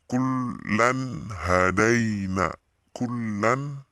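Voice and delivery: KULLAN HADAINA very slow, clear n; clear recitation. Shown as a demonstration.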